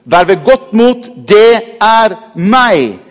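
calm but strong reassurance, very slow; slow, weighty repeat. Shown as a demonstration. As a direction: stretch out the words slowly, each one weighed. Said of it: "Bare legg merke til den".

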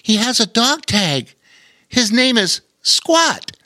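put-on high boyish voice, slowing on the name; young boy voice. A narrator's reading. Lore reading the dog tag, high boyish voice. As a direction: announce the dog's name.

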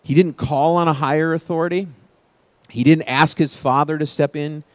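usual speaking voice

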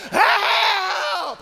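long, high imitated yell for help; mock panic, voice thrown way up and loud; freaking-out scream. Storyteller mimicking the kayaker freaking out, drawn-out panicked scream. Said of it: "he's just completely freaking out".

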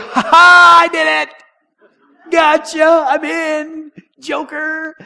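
high, loud shout of glee; gloating triumph. Retold or imitated. Sinner sneaking into heaven taunts Jesus, gleeful shouting. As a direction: crow like you cheated the system. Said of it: "you're like".

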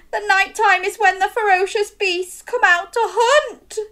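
put-on high, bright child voice; boy voice. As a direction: say it like an eager little boy. Boy speaking in story.

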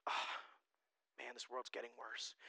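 very soft, low, resigned sigh of words; quiet resignation. Acted. Pastor voicing his reaction to world events, quietly resigned. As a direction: let it drop, resigned and hushed.

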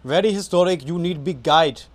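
put-on loud, high street-guide Indian accent. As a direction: bark it like a pushy Indian tour guide. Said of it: "Nick has been practicing his Indian accent".

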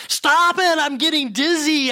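high-pitched dizzy protest; screamed plea. Acted. Spinning teacup screams at the master, high frantic plea. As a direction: cry out high, begging it to stop. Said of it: "I screamed".

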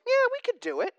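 high eager squeak of the brothers; eager disciples. Retold or imitated. Disciples James and John answering Jesus, squeaky eager. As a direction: pitch it way up, overeager. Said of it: "And James and John go".

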